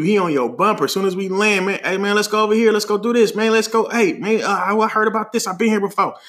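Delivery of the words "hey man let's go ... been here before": higher, eager urging; pushy friend. Retold or imitated. Friend dragging group around after landing, eager high voice. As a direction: imitate an excitable travel buddy hustling everyone along.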